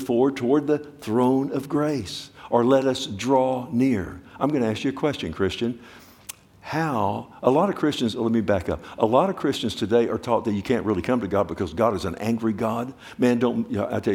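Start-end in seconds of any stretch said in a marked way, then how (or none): none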